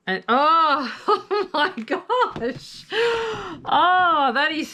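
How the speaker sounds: high, slow, laughing oh my gosh; high delighted squeal with a laugh; thrilled squeal. Real, not acted. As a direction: swoon over it with a squeal. Thrilled at stunning paper.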